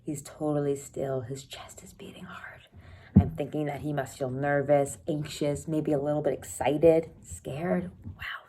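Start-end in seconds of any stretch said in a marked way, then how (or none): 1.2-2.7 unvoiced hushed aside to the class; whisper. Real, not acted. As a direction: drop to a secret whisper.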